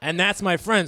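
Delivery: normal talking voice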